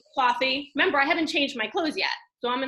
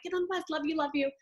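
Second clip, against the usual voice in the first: hurried tender goodbye; 5 semitones higher, 4 dB quieter, faster than usual. Retold or imitated. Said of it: "I'm like".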